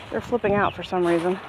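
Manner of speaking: usual speaking voice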